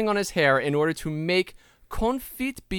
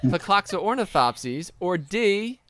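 slow quiz options; much slower than usual. Acted. Quizmaster drawing out options.

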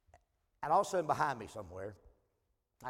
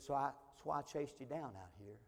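hushed and much softer; confiding quietly; 2 semitones lower, 10 dB quieter than usual. Retold or imitated. Stranger confessing his past to the preacher, quiet and confiding. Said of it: "his tone began to change".

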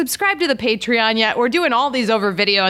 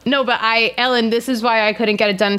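ordinary talk, nothing marked

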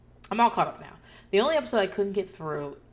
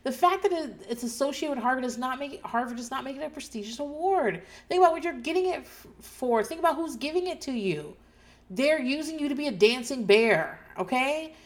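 exasperated lecture; 7 semitones higher than usual. Retold or imitated. Podcaster lecturing Danny about the award, exasperated and raised. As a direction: scold him like a friend who won't listen. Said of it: "And I'm like".

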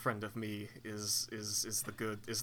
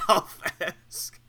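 laughing; 10 semitones higher, 7 dB louder, much slower than usual. Real, not acted. Laughing repeat.

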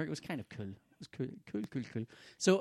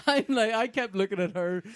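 laughing while telling; 8 semitones higher, 9 dB louder than usual. Real, not acted. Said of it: "laughing while telling".

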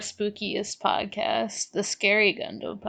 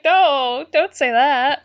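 a touch louder, higher mock Amuro; mock Amuro reassurance; 5 semitones higher, 7 dB louder, slower than usual. Amuro reassuring Frau, lightly voiced protest. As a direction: play Amuro brushing it off. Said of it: "and I'm like".

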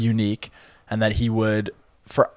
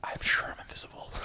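whispered Drax line; 13 dB quieter than usual. Retold or imitated. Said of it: "let's get some Drax lines".

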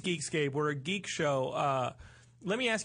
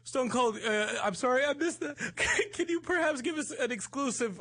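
laughing; 10 semitones higher than usual. Real, not acted. Laughing while asking exclusive.

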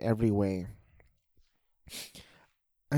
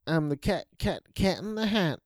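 high, bouncy goofball voice; hammed-up singsong prancing; Cat in the Hat; 8 semitones higher than usual. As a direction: play a silly, carefree cartoon figure. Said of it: "basically the anti-Grinch".

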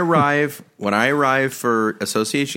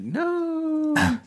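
a squeaky, much higher playful "No."; defiant high no; 4 dB quieter than usual. Retold or imitated. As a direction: refuse like a stubborn kid, pitched way up. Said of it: "I'll be like".

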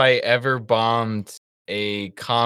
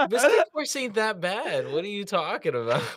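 mock incredulous; 7 semitones higher, 6 dB quieter than usual. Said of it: "I was like".

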